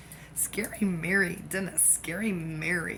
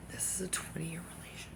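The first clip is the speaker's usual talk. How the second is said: whispered confession; soft hushed aside; 11 dB quieter than usual. Real, not acted. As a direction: breathe it out close to the mic. Aside continues softly.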